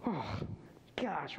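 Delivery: ordinary talk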